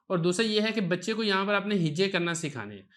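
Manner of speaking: ordinary talk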